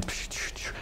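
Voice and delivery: quick unvoiced "psh" sounds; soft dismissive swishes; imitated swishing noises. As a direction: mime striking items with soft pshes. Imitates going through the expense list.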